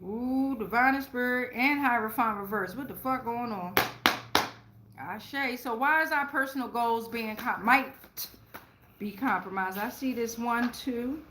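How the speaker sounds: ordinary talk, nothing marked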